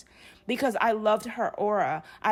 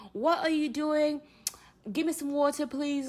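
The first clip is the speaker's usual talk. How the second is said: polite British request in a higher voice; British accent; 5 semitones higher than usual. Shown as a demonstration. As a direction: demonstrate a put-on British accent, higher-pitched. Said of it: "back in the days I could do it".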